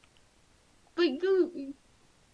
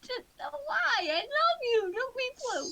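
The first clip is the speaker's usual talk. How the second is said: put-on high baby talk; 11 semitones higher than usual. Cooing at the chocobo.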